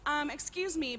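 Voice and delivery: lightly imitating a prissy neighbor; mock polite neighbor. Friend mimics the neighbor confronting him, prim and mock-polite. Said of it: "walks up to him and says".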